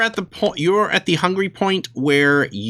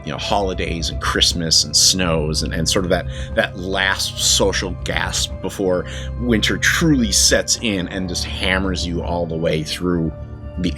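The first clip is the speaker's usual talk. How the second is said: story narration; 6 semitones lower than usual. Acted. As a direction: set the scene like a storyteller. GM opens the vampire story.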